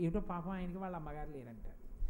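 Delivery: low, soft sympathetic murmur; pitying onlooker. Retold or imitated. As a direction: voice a bystander's mild pity. Voicing onlookers pitying a motherless child. (జాలిపడుతూ తగ్గు స్వరంలో)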